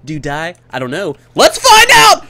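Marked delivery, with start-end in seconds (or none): 1.4-2.2 high, loud excited yell; gung-ho shout; excited battle cry. Real, not acted. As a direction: launch into it with gusto. Decides to test dying, hyped.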